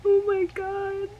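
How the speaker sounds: high, teary, overwhelmed gushing; pitched high and nearly crying with joy; overjoyed squeal. Real, not acted. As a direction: squeal over the new camera like a dream come true. Dream camera unboxing, overjoyed.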